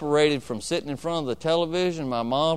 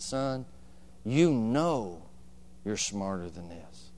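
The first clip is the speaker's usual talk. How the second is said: soft, low, calm rebuke; calm mother; 4 semitones lower, 6 dB quieter, slower than usual. Retold or imitated. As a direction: correct him gently, no anger. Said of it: "She didn't yell".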